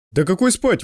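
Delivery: mild indignant retort. A narrator's reading. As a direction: brush the idea off, a touch outraged. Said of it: "возмутился проводник". (с лёгким возмущением)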